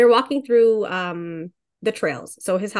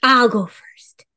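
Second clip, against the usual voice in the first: whisper; 6 dB louder than usual. Acted. Playful whisper before starting story.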